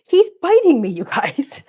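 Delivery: high and loud, laughing through the words; laughing squeal. Real, not acted. Cat bites her, she laughs.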